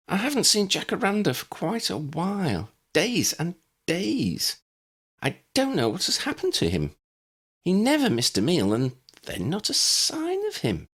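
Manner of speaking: high, fretful neighbour voice; an anxious old lady reporting a missing cat; worried neighbour. A narrator's reading. Betty voiced in story.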